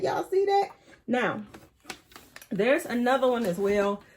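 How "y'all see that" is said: pitched even higher, squealing with delight; Y'all see that? pushed way up high; more excited. Real, not acted. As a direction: top the first ask, practically squeaking. Repeats, even more thrilled.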